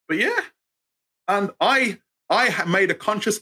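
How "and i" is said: ordinary delivery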